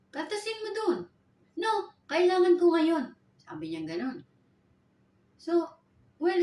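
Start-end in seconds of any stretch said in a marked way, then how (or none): 0.1-1.0 high, cheeky comeback; defiant retort. Retold or imitated. Maid defies employer about salary. 1.6-3.2 slow, loud, higher insistence; insistent refusal. Retold or imitated. Employer insists on money now.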